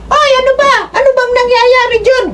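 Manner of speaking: put-on high panicked old-woman shriek; panicked mother. Mother bursting out of the room at the fighting, high panicked voice. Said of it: "talagang panik na panik".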